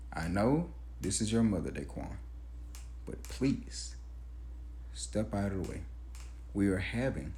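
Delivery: low, hushed, measured; quiet restraint. Retold or imitated. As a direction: keep it down, forcing the calm. Forced calm with girlfriend's son.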